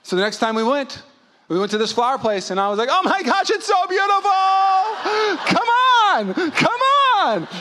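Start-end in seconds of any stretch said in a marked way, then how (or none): 2.9-7.5 hammed-up, very high-pitched shouted gushing; faked enthusiasm. Husband doubling down at the flower place, wildly over-enthusiastic. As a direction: overact delight at full tilt. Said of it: "at least fake that you like".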